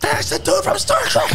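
very high, mock-thrilled outburst; excited recognition. Viewer spotting the actor on another show, excited recognition. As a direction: squeal it like a thrilled fan.

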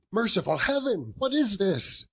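high, anguished outcry; horrified cry. Acted. Scrooge recoils at the bare deathbed, crying out high. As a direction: cry out in horror at the sight.

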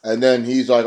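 usual speaking voice

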